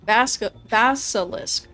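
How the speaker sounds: basilisk sounded out slowly, BASS-ih-lisk; sounding it out. Shown as a demonstration. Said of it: "Sound it out for me".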